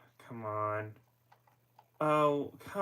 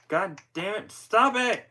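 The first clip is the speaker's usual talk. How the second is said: loud, high exasperated shout; angry yell; 9 semitones higher, 10 dB louder than usual. Real, not acted. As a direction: curse and yell at the device. Controller acting up on its own.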